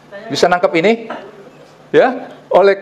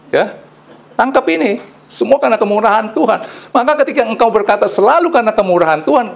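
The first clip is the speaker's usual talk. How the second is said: high, insistent preaching voice; emphatic preaching; 8 semitones higher than usual. Acted. Preacher telling listeners to involve God in life, emphatic and high. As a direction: declaim the verse, then drive the point home in a raised pitch. Raised preaching and scripture reading.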